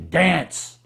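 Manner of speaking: loud rough one-word command, imitating the guru. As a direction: snap it at the reluctant monks. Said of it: "in a rough voice".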